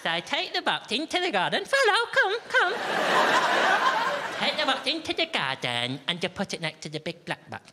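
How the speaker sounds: mock-patient nursery voice for the housemates; sing-song voice for housemates. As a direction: talk down to them like a nursery teacher. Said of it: "Why are you talking like that".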